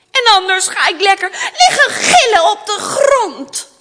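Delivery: high, loud acted tantrum; spoilt child's threat. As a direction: threaten like a demanding little princess. Spoilt princess threatens to scream. (verwend, luid dreigend prinsesje)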